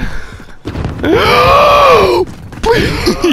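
loud gasp for air; gasp after holding breath. Real, not acted. Told to hold breath in gas.

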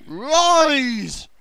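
high, loud grand command; hammed-up booming order; mock command. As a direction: declare it like a wizard summoning.

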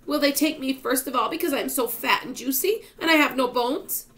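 high, loud fretful wail; panicky, high-pitched lament. A narrator's reading. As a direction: wail it out in self-pity. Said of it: "wailed the Earthworm".

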